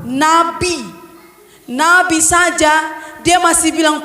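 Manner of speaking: ordinary talk, nothing marked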